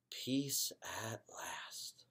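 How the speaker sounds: soft, slow sigh of relief; whispered relief. A narrator's reading. Hamster relieved the house is finally silent, slow whisper.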